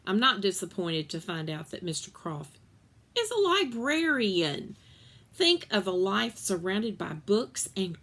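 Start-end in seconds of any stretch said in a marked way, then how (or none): none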